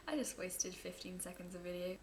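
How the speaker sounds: giggled whispered aside; laughing whisper. Real, not acted. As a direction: whisper it to the camera. Aside after greeting mum.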